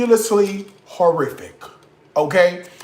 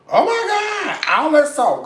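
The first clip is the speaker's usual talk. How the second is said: mocking commenter; 9 semitones higher, 3 dB louder than usual. Mocking a viewer worried about salt, high mock shock. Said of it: "before you get in my nerve".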